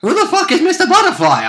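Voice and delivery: high-pitched, laughing outburst of disbelief; mock incredulous question. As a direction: squeal it as a baffled listener would. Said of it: "I hear you say".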